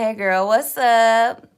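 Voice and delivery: put-on high, loud, drawn-out hey girl; playing a chatter greeting her; mock girly greeting. Streamer imitates a viewer's greeting, high and loud. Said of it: "you saying".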